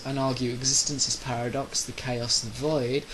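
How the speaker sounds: ordinary delivery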